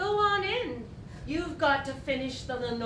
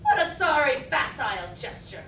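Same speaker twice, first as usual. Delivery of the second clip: usual speaking voice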